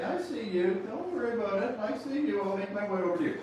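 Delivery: flirt sweetly across the room; coy flirty murmur. Acted. Salesman coaching buyers, flirting with a car. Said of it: "you kinda glance up and say".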